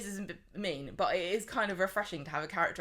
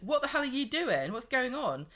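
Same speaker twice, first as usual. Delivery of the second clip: higher mock outburst; a mild mock outburst, higher voice; mock Jack; 7 semitones higher than usual. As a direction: ham up an alarmed shout. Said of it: "in walks Jack going".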